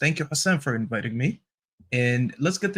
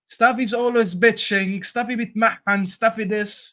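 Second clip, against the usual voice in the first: high-pitched mock griping; worked-up mimicry of complaints; mocking imitation; 7 semitones higher, 5 dB louder than usual. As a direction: parody a nagging critic, pitched well up. Mimicking complaints about himself in banter.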